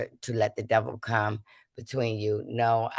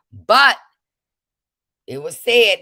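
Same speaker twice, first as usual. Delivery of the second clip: much louder, higher burst; loud outburst; 8 semitones higher, 11 dB louder, slower than usual. Real, not acted. Speaker bursting out to viewers about what was said, loud. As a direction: burst out with it, heated. Heated accusation about receipts.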